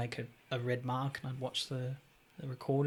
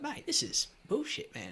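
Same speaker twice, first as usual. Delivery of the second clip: indignant complaint; 12 semitones higher than usual. Retold or imitated. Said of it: "I was like".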